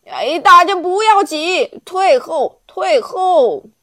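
put-on old onion shouts at the pushing crowd; shouting. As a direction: yell at the crowd to get back. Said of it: "老洋葱大叫".

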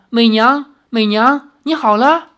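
high, loud joyful calling; elated husband's exclamation; overjoyed. Acted. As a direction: call to your revived wife in delight. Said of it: "大喜过望".

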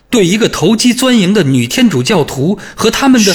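high, loud, contemptuous outburst; spiteful tirade. A narrator's reading. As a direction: spit it out in contempt.

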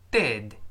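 "did" with a short, open vowel, close to "ded"; "did" with a lax vowel, not "deed". Shown as a demonstration. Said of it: "短音发a".

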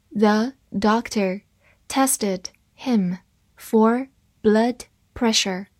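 slow, word by word read of "The doctor tested him for blood pressure". Shown as a demonstration. Teacher reads slowly for students, deliberate. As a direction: read it slowly for learners to follow. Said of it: "好，慢慢来读。".

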